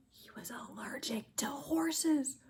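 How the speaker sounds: breathy hushed reveal; whispered reveal. Acted. Reader hushing the funny twist for children, whispered.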